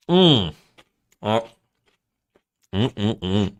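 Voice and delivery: chewing pickle, mouth full; crunchy bites of pickle; crunching a pickle. Real, not acted. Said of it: "pick up my, my pickle bite".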